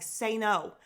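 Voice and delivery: loud insistent coaching; urging loudly. Retold or imitated. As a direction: push her to say it, loudly. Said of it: "And I'm like".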